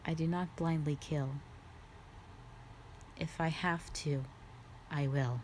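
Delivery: quiet regret. Acted. Dragon softly confessing to the human that she kills only when asked.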